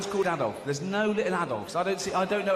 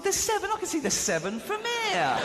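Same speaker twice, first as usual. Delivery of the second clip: exasperated. Retold or imitated. Exasperated at Douglas in casino.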